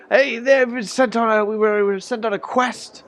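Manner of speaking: put-on old man explains his quest. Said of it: "he's like".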